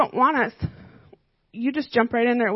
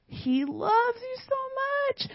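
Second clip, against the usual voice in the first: high, slow and soft, full of love; hushed, high and lingering; tender; 12 semitones higher, 6 dB quieter, much slower than usual. Real, not acted. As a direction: let it float up gently, softer and slower. Telling kids of God's love.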